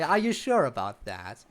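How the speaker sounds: usual speaking voice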